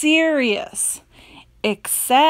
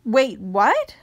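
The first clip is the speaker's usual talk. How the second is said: usual speaking voice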